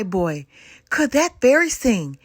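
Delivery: usual speaking voice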